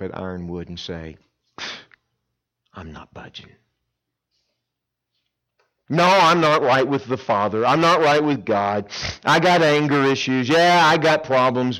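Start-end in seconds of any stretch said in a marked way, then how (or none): 2.7-3.5 soft, low, set refusal; quiet stubborn refusal. Pretend. Camper's stubborn refusal, hushed. 5.9-11.8 loud mock-defiant camper; loud defiant confession.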